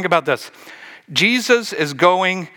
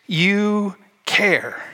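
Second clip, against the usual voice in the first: slow, drawn-out resentful complaint to Jesus; Martha complaining; 5 semitones higher, much slower than usual. Acted. Martha to Jesus, slow and resentful about Mary. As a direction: stretch each word, ticked off and aggrieved. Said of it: "she says these words".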